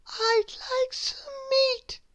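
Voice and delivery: slow, high, tiny whisper; small breath of a voice. A narrator's reading. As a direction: whisper timidly, drawing it out. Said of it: "he whispered".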